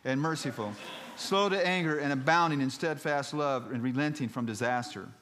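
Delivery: emphatic, high-pitched sermon voice; dramatic preaching. Acted. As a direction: roll out the prayer from the pulpit. Continues dramatic scripture reading.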